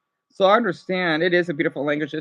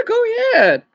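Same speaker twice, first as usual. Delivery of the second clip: high, bright urging; excited; 14 semitones higher, 4 dB louder, faster than usual. Real, not acted. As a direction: cheer the viewer on.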